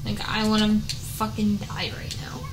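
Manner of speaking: soft whisper of defeat; whispered despair. Real, not acted. Gamer whispering in despair at the game. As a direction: breathe it out, beaten.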